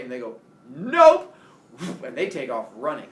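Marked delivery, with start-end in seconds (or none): 0.7-1.3 high, shouted one-word refusal; emphatic refusal. Retold or imitated. Friends told they may stay, loud hasty refusal. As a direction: yell it as you bolt.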